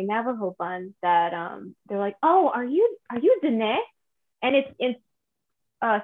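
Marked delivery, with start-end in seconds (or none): 2.2-3.8 higher, tentative mock question; curious stranger. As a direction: imitate someone hesitantly guessing, pitched up. Imitates people recognising them.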